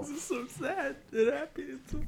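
high, squeaky fake sob; mock crying. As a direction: pretend to cry over a silly story. Mock crying along with him.

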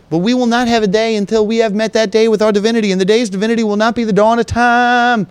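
fast, high, mock-prophetic proclamation; mock grandiose oration. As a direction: rattle it off like a hammed-up prophecy. Grandiose mock-prophetic sign-off speech.

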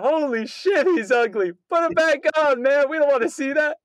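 high, loud mock yell at the ugly face; retold yell. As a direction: shout it out from the theater seat, half laughing. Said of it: "she just yelled out".

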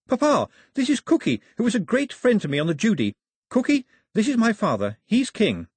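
put-on high, excited girl's voice; bright-eyed daughter, pitched well up; eager daughter. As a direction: introduce a friend proudly in a high voice.

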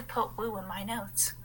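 breathy embarrassed whisper; whispered aside. Real, not acted. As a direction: confess it under your breath.

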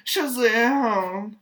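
loud, high cry of "Shazam!"; shouted name. Pretend. Baby Shazam crying out his own name in a loud, high shout. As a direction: belt the name out like a hero's war cry. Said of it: "He's like".

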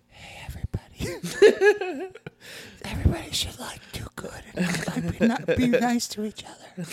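high breathy whispered God voice; God's voice. Pretend. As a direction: whisper it like a gentle deity. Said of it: "God's voice is like".

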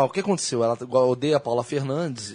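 lawyer voice. Acted. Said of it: "eu fico imaginando um advogado".

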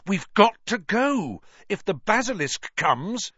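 high, loud urgency; alarmed high urging; urgent. Acted. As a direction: push him to leave now. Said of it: "said Harry urgently".

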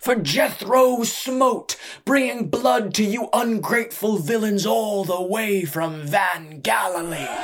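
loud, high ringmaster's shout, drawn out; thunderous showman's introduction; thundering. A narrator's reading. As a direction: whip up the crowd with a huge stretched-out announcement. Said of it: "he thundered".

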